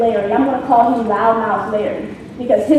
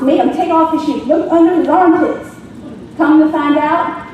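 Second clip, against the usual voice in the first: ordinary delivery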